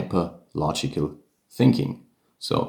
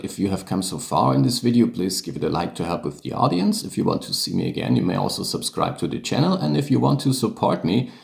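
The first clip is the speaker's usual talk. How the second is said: brisk, hurried housekeeping aside; quick aside; faster than usual. Real, not acted. Podcaster asking viewers for likes and support, quick aside. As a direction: rattle through the channel plug. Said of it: "just one quick word".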